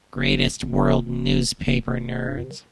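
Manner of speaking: slow, deep processed voice; Max Headroom voice. Shown as a demonstration. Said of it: "let's turn into Max again".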